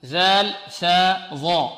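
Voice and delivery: ذال ثا ظا drawn out slowly; letter drill. Shown as a demonstration. Teacher drilling similar letters, slow. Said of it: "الذال هنا معجمة".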